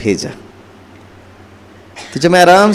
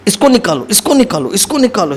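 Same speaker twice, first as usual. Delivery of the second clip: normal talking voice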